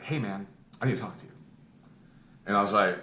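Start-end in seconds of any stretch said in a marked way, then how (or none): 0.0-1.3 low, soft, quick aside; quiet imitation of a discreet word; low-key confidential approach. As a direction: murmur it low and fast, imitating him. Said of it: "he says it just like that".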